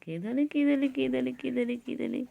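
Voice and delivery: high playful coo. Real, not acted. High baby talk.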